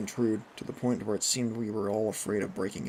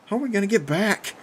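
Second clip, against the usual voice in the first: high, raised, alarmed yell; 9 semitones higher, 6 dB louder than usual. A narrator's reading. Bo stranded in the water with friends, yelling. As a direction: cry it out in alarm. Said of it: "Bo yelled".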